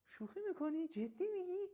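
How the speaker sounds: high, astonished, words leaping up; surprised disbelief. Shown as a demonstration. Teacher acting amazed at startling news, surprised. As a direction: sound stunned by what you just heard. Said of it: "حالت شگفتی".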